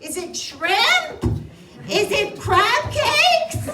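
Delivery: ordinary talk, nothing marked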